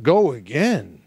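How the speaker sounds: slow, wavering disbelief; offended actor repeating the order; incredulous echo. Retold or imitated. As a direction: stretch GO AGAIN, swooping up and down. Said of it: "And I'm going".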